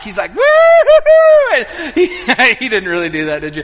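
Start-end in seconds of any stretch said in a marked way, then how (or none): 0.4-1.5 long high loud whoop; hammed-up imitation of a thrilled kid whooping; excited whoop. Son rocking the stuck kayak, hammed-up high gleeful whoop. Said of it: "he starts wanting to rock".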